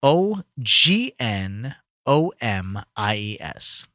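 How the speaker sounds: slow letter-by-letter spelling. Shown as a demonstration. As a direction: spell it out, one letter at a time. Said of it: "don't know if I said it right".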